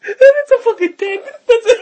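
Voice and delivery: high, loud, drawn-out laughing cry; helpless laughter. Real, not acted. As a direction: squeal it out through real laughter. Helpless laughter at own joke.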